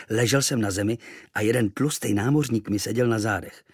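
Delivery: plain reading voice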